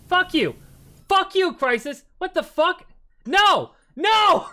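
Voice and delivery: yelling high and loud in outrage; furious shout. Real, not acted. Streamer yelling at donors in real outrage. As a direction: scream it at the chat. Streamer reacts to donation troll.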